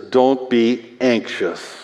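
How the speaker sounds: Don't be anxious, drawn out slow and soft, Don't leaning in; slow emphasis. Shown as a demonstration. Preacher reads the verse slowly to the anxious listeners. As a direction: lay it down gently and slowly. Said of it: "And then it says".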